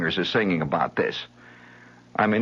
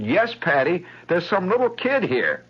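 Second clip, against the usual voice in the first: disdainful mother; 9 semitones higher than usual. Retold or imitated. Mother tells daughter a kid is at door, sniffy. Mother voiced answering Patty.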